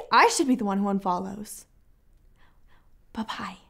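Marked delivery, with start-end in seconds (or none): 3.1-3.6 soft whispered goodbye; whispered bye. Acted. Sarcastic goodbye while leaving.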